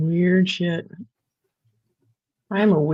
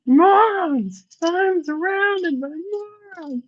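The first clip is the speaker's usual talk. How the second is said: mock exasperated shout; 12 semitones higher, slower than usual. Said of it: "Sorry, I had to".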